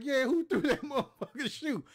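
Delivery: high, loud echo broken by a laugh; the teen mocking the sergeant's question, laughing; laughing mock shout. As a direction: throw his question back, cracking up. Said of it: "I was like".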